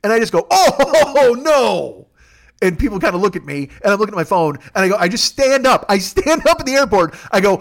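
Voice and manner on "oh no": a high, loud groan of disbelief with a laugh; shocked oh no. Real, not acted. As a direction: blurt it out, stunned and half laughing. Sees shocking trade news in airport.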